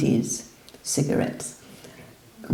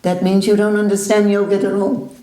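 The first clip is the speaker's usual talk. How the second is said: loud, higher rebuke; scolding teacher; 6 semitones higher, 4 dB louder than usual. Retold or imitated. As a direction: tell him flatly he's wrong.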